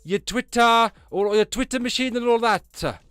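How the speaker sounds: put-on loud, high-pitched Welsh accent; big Welsh voice à la Lee Evans. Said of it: "you want a Welsh accent".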